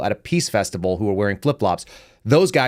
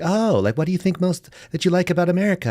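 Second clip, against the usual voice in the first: high-pitched mock interest; curious American classmate; 7 semitones higher than usual. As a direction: imitate an eager classmate, pitched well up. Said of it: "they'd be like".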